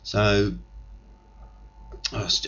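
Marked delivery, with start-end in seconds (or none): none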